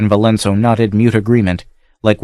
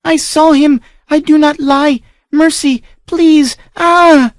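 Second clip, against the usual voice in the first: terrified screaming; 4 dB louder, slower than usual. Acted. Child screams under the whip.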